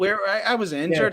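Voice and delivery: high-pitched mock Northern Irish accent. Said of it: "Northern Irish accent".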